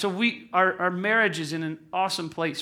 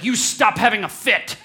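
loud, raised imitation of a scolding kid; mock child's scolding; 3 semitones higher, 7 dB louder than usual. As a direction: tell off a parent like an indignant preschooler. Voices daughter scolding him mid-fight.

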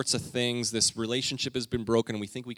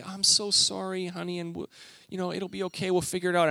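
sweet comforting; 8 semitones higher than usual. Retold or imitated. Mom consoling daughter about the wrong doll, gentle and high. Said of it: "handles this very sweetly".

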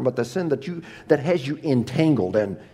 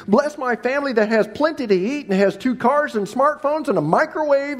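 mock pleading prayer; 11 semitones higher, 5 dB louder than usual. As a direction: ham up a greedy prayer, rising to a high wail on the end.

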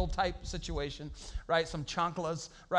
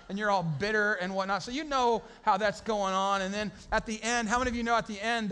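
ordinary delivery

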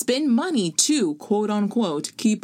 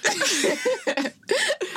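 high laugh. Real, not acted. Laughs after sheesh.

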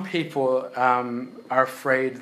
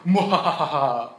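hammed-up villain cackle; a slow theatrical evil laugh; 4 dB louder, slower than usual. Evil parent mock laugh.

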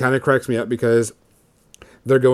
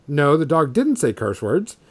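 ordinary delivery

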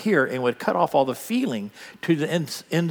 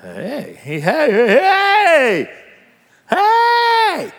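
calling out, building; 13 semitones higher, 9 dB louder, much slower than usual. Acted. Preacher acting a healed man shouting back to Jesus, building to a yell. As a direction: call across a field, getting louder each time.

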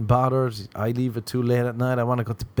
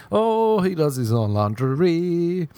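mock girl realization; 6 semitones higher, slower than usual. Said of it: "She realized".

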